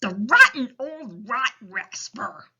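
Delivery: loud, slow giant outburst; big storybook giant indignation; indignant cry. A narrator's reading. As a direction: cry out in outrage, stretching each word. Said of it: "cried the B F G".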